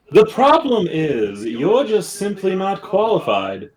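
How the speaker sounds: high, slow, drawn-out put-down; Peck's character voice. Acted. Peck telling the companion they're unqualified, high and condescending. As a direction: pitch it up and stretch each word. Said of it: "Peck was just gonna go".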